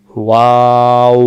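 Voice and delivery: ওয়াও held long, lips rounded; slow model of ওয়াও from the lips; ওয়াও stretched very slow; drawn-out waw. Shown as a demonstration. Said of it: "দুই ঠোঁট থেকে উচ্চারণ হয়".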